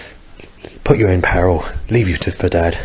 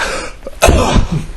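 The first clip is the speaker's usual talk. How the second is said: a harsh, painful hacking cough; 12 semitones higher than usual. Real, not acted. Coughing to show the viewers the pain. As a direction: cough to show how much it hurts. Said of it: "I'll show you what the pain's like".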